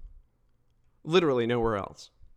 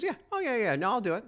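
high, breezy mock Trump; imitating Trump; 9 semitones higher than usual. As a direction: toss it off like a shrugging Trump. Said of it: "Trump looks at the person and goes".

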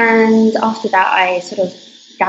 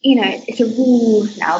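usual speaking voice

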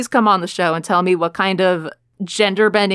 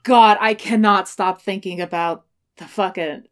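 normal talking voice

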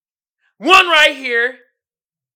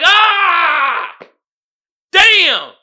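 loud, high cry of falling; mock pained yell; 8 semitones higher, 10 dB louder than usual. Student tipping back in a class chair, mock pained yell. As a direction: yelp as if crashing backward. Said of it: "You're like".

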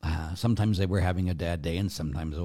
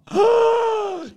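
shocked gasp; 9 dB louder than usual. Retold or imitated. Caller's shocked reaction.

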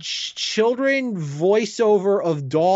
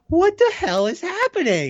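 baffled disbelief; 11 semitones higher than usual. Retold or imitated. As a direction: pitch it way up in total disbelief. Retelling his baffled reaction.

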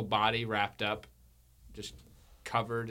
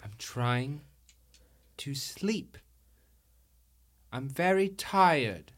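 put-on sleepy little girl; 3 semitones higher, slower than usual. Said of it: "girl wakes in bed".

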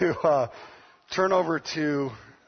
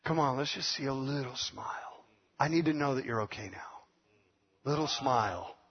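soft, low coaxing plea; 4 semitones lower, 8 dB quieter than usual. Retold or imitated. Father softly begging his daughter for a smile. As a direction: gently beg for a smile. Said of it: "father coaxing daughter".

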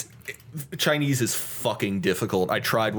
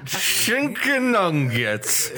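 laughing; 7 semitones higher, much slower than usual. Pretend. Worker voice through laughter.

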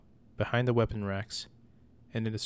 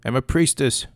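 very loud, higher furious scream; 3 semitones higher, 10 dB louder than usual. Acted. Mother screaming at her son while squeezing his ear. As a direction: scream it with full rage. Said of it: "she screamed as she squeezed".

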